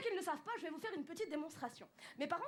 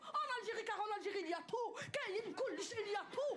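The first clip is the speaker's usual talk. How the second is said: higher, forceful Algerian accent; 6 semitones higher than usual. Shown as a demonstration. A comedian demonstrates how Algerians talk, virulent Algerian accent. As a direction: punch it out like a fiery Algerian. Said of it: "ils sont un petit peu plus virulents".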